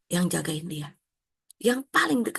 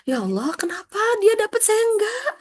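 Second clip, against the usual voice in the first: high, louder mock bewilderment; puzzled asking; 14 semitones higher, 4 dB louder than usual. Heaven dweller asking God why others got more, high puzzled. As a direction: ask God in wide-eyed wonder. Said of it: "ada orang-orang itu heran".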